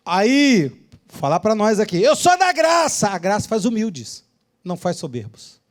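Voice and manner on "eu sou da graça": high, indignant protest; acted-out self-righteous retort; mock proud believer. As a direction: protest loftily, pitched up.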